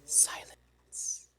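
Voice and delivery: breathy whispered silence. Acted. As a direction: hush it out as the staff. Staff answers with silence.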